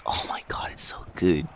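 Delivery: breathless whisper of delight; excited whisper. Real, not acted. Fan whispering about the dream match, hushed and thrilled. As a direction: whisper it like a secret treat.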